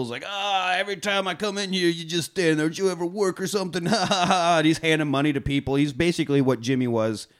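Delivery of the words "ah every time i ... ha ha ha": high, jovial ribbing with mock laugh; joking. Gangster joking with staff in the hallway, high playful ribbing. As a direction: rib the staff in a raised voice. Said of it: "he's even joking with people".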